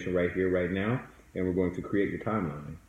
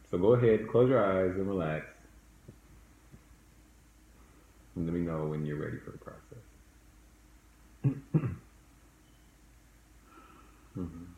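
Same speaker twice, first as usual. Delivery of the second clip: soft spa whisper; 2 semitones lower, 5 dB quieter than usual. Acted. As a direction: lead them calmly into the past. Leads a guided meditation.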